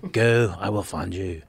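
low, slow Neeson threat; quiet menace in Irish Neeson voice; Liam Neeson. Retold or imitated. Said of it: "picture him".

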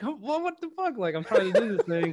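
high-pitched comic protest; mock exasperation. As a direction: replay your frustration, hammed-up and pleading. Retold impatience during wives' chat.

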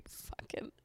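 hushed curse under breath; whispered curse. Real, not acted. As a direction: drop to a whisper on "fucking". Outraged aside.

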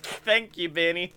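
usual speaking voice

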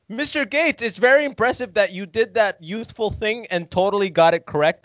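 high, mock-impressed praise; high character voice. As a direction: gush up high. Character addresses Bill Gates.

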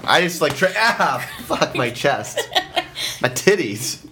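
ordinary delivery

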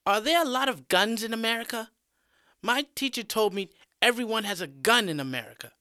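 high, quick schoolkid voice; curious student. A narrator's reading. Random student questions the teacher about America, high and quick. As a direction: ask it fast in a high student voice. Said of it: "a random student retorts".

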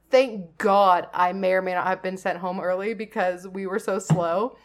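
usual speaking voice

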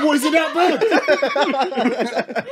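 high, slow, drawn out through laughter; laughing disbelief. Retold or imitated. Retelling his reply to his brother, laughing high and slow. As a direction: ask it while cracking up. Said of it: "I said".

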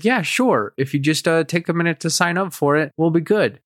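a lightly voiced cheerful nurse saying yes; friendly nurse. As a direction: play the chirpy helpful nurse agreeing.

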